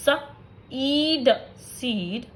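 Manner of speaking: slow blending with pauses between sounds; sounding out beat. Shown as a demonstration. Teacher giving long vowel examples, sound by sound. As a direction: say ब, ई, ड separately, then blend them into बीट with a long ई. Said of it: "For example".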